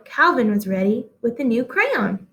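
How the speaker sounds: ordinary delivery, nothing marked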